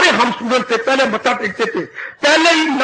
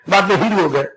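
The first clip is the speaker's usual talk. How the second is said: usual speaking voice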